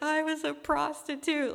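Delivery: high, loud, tearful blurt; reliving the moment she broke down crying; a confession that bursts out through tears; retold bawling. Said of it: "And I just started bawling".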